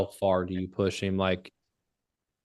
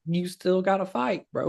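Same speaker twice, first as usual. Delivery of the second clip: imitated onlookers' reminder; 9 semitones higher than usual. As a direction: pitch it way up, imitating the people setting him straight. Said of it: "And they're like".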